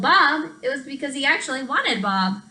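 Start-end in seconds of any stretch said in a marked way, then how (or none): 0.0-0.5 loud high call; yelling. Acted. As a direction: yell his dog's name. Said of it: "when he yelled".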